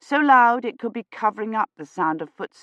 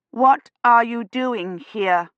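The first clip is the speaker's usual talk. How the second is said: slow, deliberate words; forced slow; 5 semitones higher, 3 dB louder, slower than usual. A narrator's reading. Woman to a stranger in church, forced slow speech. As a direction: spell each word out slowly. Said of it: "forcing herself to speak more slowly".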